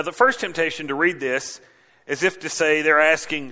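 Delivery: ordinary delivery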